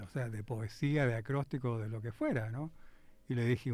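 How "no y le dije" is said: ordinary talk, nothing marked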